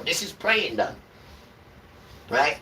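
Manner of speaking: usual speaking voice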